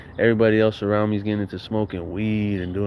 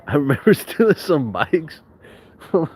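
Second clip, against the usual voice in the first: laughing; 5 semitones higher than usual. Real, not acted. Laughing while recalling theft.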